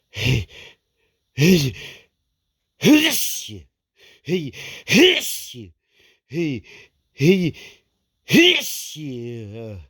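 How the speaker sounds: sneezes rising to a burst; sneeze windup. Real, not acted. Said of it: "here come the sneezes again".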